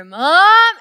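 put-on high, loud call of a scared child; a child's sharp cry of Mom. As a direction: call out for mom like a nervous little boy. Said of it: "I hear".

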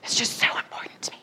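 mock breathy stage whisper, quick and hushed. Hillary stage-whispering her passion to the rally crowd, mock whisper. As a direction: whisper it like a big secret that matters. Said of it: "weird whispering thing where she was like, ... stage whispering".

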